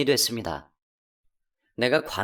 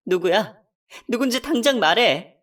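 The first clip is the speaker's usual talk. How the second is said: higher and louder, demanding the name; furious demand; 7 semitones higher, 4 dB louder than usual. Acted. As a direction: bark it at him. Wife demands mistress's name. (남편에게 상간녀가 누군지 따지는 아내, 분노한 외침)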